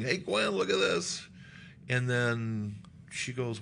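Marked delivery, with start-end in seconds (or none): 0.0-1.3 high, stretched-out call to his wife. Acted. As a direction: call her over excitedly, slow and pitched up. Calls wife to see fish.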